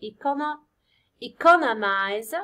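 economize slow and firm, e-CO-nomize; stress on CO. Shown as a demonstration. Teacher confirming the stress on C, slow e-CO-nomize. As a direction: hit the second syllable of economize, drawing it out. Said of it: "on C".

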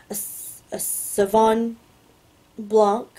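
Sauvignon, long pause, then Blanc; halting sounding-out. Real, not acted. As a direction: feel your way slowly through Sauvignon Blanc. Sounds out wine name, then rereads.

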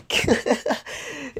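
a short high-pitched giggle; sheepish high laugh. Real, not acted. Laughing at own forgetfulness.

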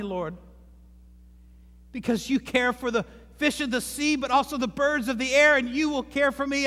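a fervent prayer of trust. Acted. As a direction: pray it with confident trust. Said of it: "It says".

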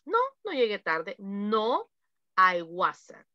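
normal talking voice

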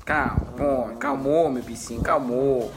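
low, gentle calming; soothing a pet. Real, not acted. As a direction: settle an upset animal tenderly.

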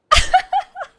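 high-pitched loud laughing fit; helpless laughter. Real, not acted. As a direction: keep cracking up. Laughing fit at game glitch.

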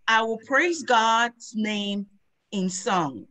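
usual speaking voice

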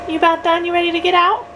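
put-on baby talk. Mom talking to baby.

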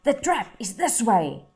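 a hint of a gruff trapper calling; gruff man's voice. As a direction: call it out like a rough hunter. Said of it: "they heard gruff voices".

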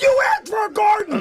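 high, loud indignant shout; loud, high shout of defence; shouted retort. Retold or imitated. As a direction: yell it back as the punchline. Retort to wife, shouted punchline.

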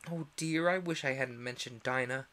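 plain reading voice

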